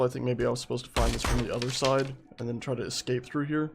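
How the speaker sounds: ordinary delivery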